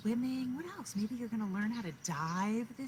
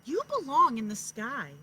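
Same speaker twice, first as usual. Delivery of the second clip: louder, firm coaxing; mother owl urging; 3 semitones higher, 10 dB louder than usual. A narrator's reading. Mother owl telling her chick she belongs in the sky, louder. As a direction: tell her gently but firmly. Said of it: "said her mother".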